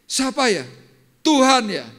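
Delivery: high, loud startled shout; frightened yell. Retold or imitated. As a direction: cry out into the empty room, scared. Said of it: "saya sambil berteriak".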